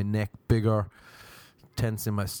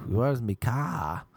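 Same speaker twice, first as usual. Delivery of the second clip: put-on slow, botched Boston accent; fail at Boston, dragging out CAR; bad Boston accent; much slower than usual. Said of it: "Boston accent".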